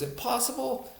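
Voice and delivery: put-on "Impossible?" thrown back in a thick Thai accent, pitch leaping high; incredulous Thai accent. Thai trainer scoffing at a doubting student, thick Thai accent, incredulous. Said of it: "with his thick accent".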